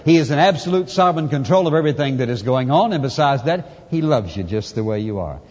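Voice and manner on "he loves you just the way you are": much lower and softer, gentle; tender hush. Acted. Pastor telling the congregation God's love, softly and tenderly. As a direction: say it tenderly, like a secret. Tender close after listing titles.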